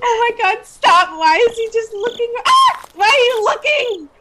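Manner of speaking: high-pitched, frantic pleading; high, half-crying exasperation; panicked wail. Real, not acted. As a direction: wail in alarm at the player. Watching game in panic.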